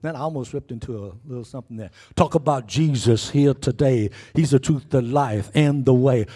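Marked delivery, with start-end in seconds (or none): 2.2-6.3 loud declared preaching; a preacher thundering to the congregation; preaching at full force. Acted. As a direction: proclaim each line loud with full fire. Preaching bursts loud over music.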